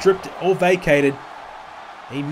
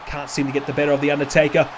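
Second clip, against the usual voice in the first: ordinary talk, nothing marked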